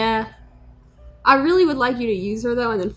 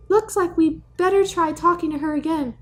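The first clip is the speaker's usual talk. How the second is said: ordinary talk, nothing marked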